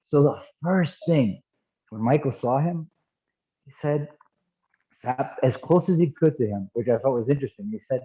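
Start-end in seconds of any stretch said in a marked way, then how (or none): none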